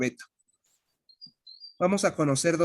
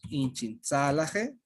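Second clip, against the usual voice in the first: careful model phrase; 3 semitones lower, 4 dB quieter, much slower than usual. Shown as a demonstration. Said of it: "pues sería".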